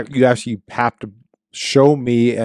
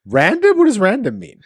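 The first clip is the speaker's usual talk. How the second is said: mock confusion; 8 semitones higher than usual.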